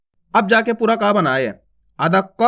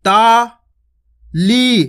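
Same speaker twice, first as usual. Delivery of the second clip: ताली stretched very slowly; drawn out; 3 dB louder, much slower than usual. Shown as a demonstration. Teacher drawing the word out for children. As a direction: hold the vowels of ताली long. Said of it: "खींच खींच के उच्चारण करना है".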